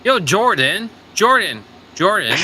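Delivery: high, loud, insistent name call. Real, not acted. Player calling a friend in chat, high insistent calling out. Calling out to get Jordan's attention.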